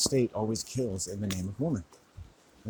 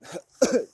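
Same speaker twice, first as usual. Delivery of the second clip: a sudden much higher, louder laugh; high burst of laughter; 14 semitones higher, 9 dB louder than usual. Real, not acted. Vlogger bursting into laughter before his story. Laugh after long pause.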